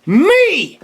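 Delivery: indignant punchline. Real, not acted. Punchline who cleaned up.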